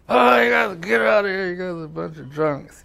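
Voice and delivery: high, loud put-on brushoff; telling drunk buddies to get lost, high and loud; mock dismissal. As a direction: wave them off in a mock voice.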